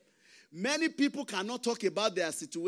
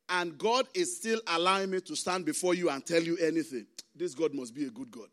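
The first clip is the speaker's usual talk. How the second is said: ordinary talk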